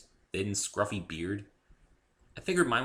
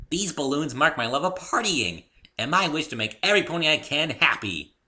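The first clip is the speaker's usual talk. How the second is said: high, loud bouncy pony voice; bubbly pony; 8 semitones higher, 7 dB louder than usual. A narrator's reading. Pinkie explaining Spike to the newcomer, high and bubbly. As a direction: chirp it like a cheerful pony.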